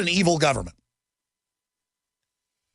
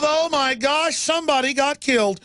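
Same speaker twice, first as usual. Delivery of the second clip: mocking gasp; 9 semitones higher than usual. Mocking media pageant.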